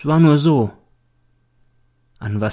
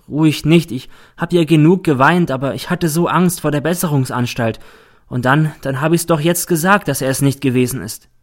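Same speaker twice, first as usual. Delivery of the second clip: put-on higher, louder girl's voice, protesting; girl defending herself; 4 semitones higher, 3 dB louder than usual. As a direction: protest your case as the accused girl. (Mädchen, das sich aufgeregt verteidigt)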